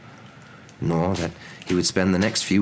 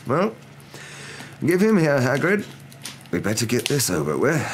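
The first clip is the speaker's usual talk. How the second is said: Dumbledore; 8 semitones higher than usual. A narrator's reading. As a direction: take charge, voice pitched up. Dumbledore taking the baby.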